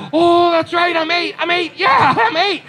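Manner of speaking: put-on high, bouncy kid voice; little boy gushing over his birthday; excited little boy. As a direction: play a thrilled eight-year-old boy.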